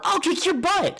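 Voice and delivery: put-on high, bright boyish shout-free bravado; imitating Luffy. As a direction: chirp it up high like the hero. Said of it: "The worst he said in those fights was like".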